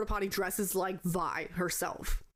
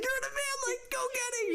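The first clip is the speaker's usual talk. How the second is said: high-pitched frantic urging; mock panic; 14 semitones higher than usual. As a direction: imitate someone shoving a friend toward danger. Acted panic in tarantula scene.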